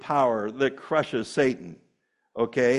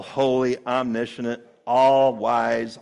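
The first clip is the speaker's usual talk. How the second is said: ordinary delivery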